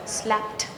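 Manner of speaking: slapped said softly, ending in a T sound; unvoiced T ending. Shown as a demonstration. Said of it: "So this will sound T".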